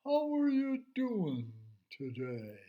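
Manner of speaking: put-on slow, easy old turtle greeting; unhurried turtle hello. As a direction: drawl it out like a gentle old turtle. Turtle Orville greets slowly.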